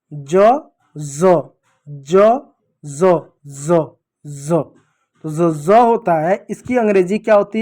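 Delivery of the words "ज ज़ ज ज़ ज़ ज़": ज alternating as j and z, then repeated as z; j and z drill. Shown as a demonstration. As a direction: drill ज: hard j against buzzing z, emphatically. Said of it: "जब ज के नीचे बिंदु लग जाएगा". (ज और ज़ का अभ्यास)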